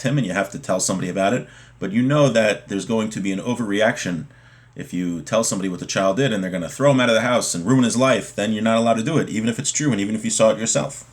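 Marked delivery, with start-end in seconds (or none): none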